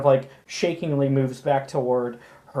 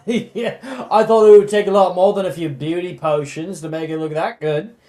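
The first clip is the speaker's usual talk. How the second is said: louder, quick sneering jeer; mocking loafer; 4 semitones higher, 9 dB louder than usual. Drunk loafer jeers at the new owner, loud and fast. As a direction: toss the insult off fast and loud. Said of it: "he says".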